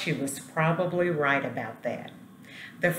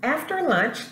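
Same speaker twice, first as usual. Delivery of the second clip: bright storytime opener; 10 semitones higher, 4 dB louder than usual. A narrator's reading. Reading story to students.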